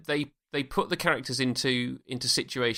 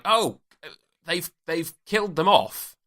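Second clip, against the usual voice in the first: mildly hammed-up surprise, pitched higher; mock shock; 4 semitones higher, 4 dB louder, slower than usual. Imagined viewer reacting to a character's death, mock shock. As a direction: act taken aback with a louder, higher "Oh".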